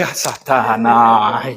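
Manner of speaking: very low, slow groan of Satanás; mock dread. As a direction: drag it out like a spooked joke. Retells reaction, jokes about devil. (Satanás arrastado e grave, de brincadeira)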